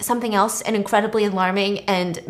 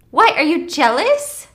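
teasing; 6 semitones higher, 4 dB louder than usual. Retold or imitated. Teasing in retold phone call.

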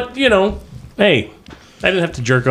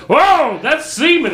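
much higher mock shocked whoa; surprised clerk; 10 semitones higher, slower than usual. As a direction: play the startled lab guy, high. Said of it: "And he goes".

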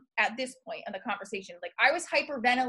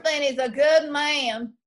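slow mock Southern wife; Southern accent; 3 semitones higher, 5 dB louder than usual. Shown as a demonstration. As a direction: try out a Southern drawl for the wife. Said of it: "Make her, make her Southern".

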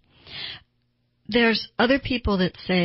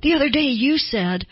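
defensive retort; 6 semitones higher than usual. Retold or imitated. A spouse deflecting blame in an argument, defensive. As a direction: push back at your partner. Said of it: "they get defensive and go".

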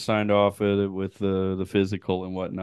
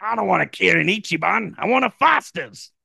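high-pitched comic Australian; mock-outraged Australian refusal; Australian accent; 10 semitones higher than usual. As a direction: ham up a broad Australian accent. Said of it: "got an Australian accent".

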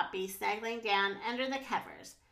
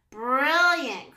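loud, thrilled exclamation; delighted cry; 2 semitones higher, 9 dB louder than usual. A narrator's reading. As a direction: burst out with delight. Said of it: "cried Poppy".

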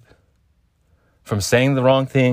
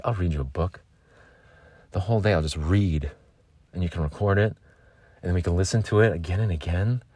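softer, lower doting voice; tender murmur; 3 semitones lower, 5 dB quieter than usual. Retold or imitated. Lovesick self softly promising to read to a partner. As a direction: promise it gently. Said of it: "That's me thirty minutes after waking up".

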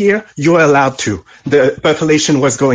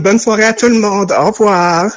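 French sign-off; 5 semitones higher than usual. Acted. Caller signs off in French.

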